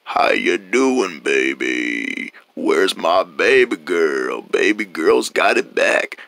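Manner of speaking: slow, deep drawn-out voice; very low voice. Acted. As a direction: show off a suddenly deep, low voice. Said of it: "Why is it so low?".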